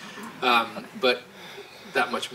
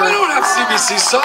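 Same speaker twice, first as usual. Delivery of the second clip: high, loud mock shock; mock outrage; 12 semitones higher, 9 dB louder than usual. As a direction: cry it out in fake dismay. Mock outrage over socks.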